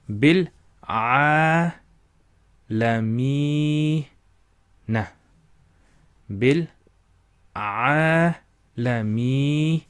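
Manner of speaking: slow "Bil a lamina" with the a drawn out; stretched vowel drill. Shown as a demonstration. Teacher modelling the stretched a. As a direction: draw out the a in "lamina" slowly. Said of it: "Notice the stretching of the voice".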